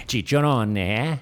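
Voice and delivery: hammed-up Italian accent with a punchy "eh"; old-school Italian accent. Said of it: "my old school Italian accent".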